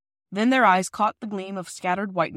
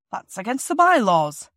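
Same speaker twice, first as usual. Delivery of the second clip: stern foreman; 2 semitones higher, 7 dB louder than usual. Acted. Foreman scolds boy running line.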